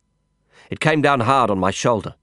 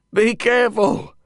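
calling out, panting; 13 semitones higher than usual. Acted. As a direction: call a warning while panting. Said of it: "I called back, panting".

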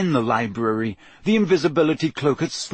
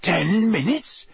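slow, higher disbelieving question; croaked disbelief; 6 semitones higher, slower than usual. A narrator's reading. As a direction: draw it out in shocked disbelief. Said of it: "Harry croaked".

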